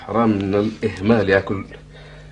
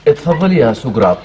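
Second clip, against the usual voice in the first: sarcastic; 3 semitones higher, 7 dB louder than usual. Acted. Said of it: "وبدون سخرية يا عبد المعين".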